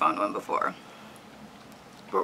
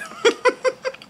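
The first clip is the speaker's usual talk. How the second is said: high squealing laugh; 6 dB louder than usual. Real, not acted. Laughing at her stale gingerbread cookie, high squeal.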